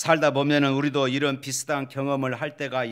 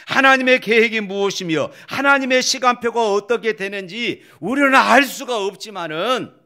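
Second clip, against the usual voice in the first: high, loud sermon delivery; impassioned preacher driving the point home; full-force preaching; 7 semitones higher, 7 dB louder than usual. Real, not acted. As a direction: proclaim it to the congregation, pitched up and loud.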